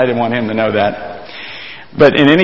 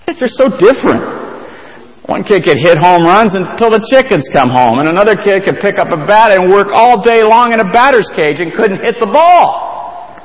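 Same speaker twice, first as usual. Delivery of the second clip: fervent preaching; 8 semitones higher than usual. Acted. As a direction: drive the point home from the pulpit. Preaching at full force.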